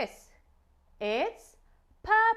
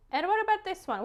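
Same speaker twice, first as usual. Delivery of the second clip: usual speaking voice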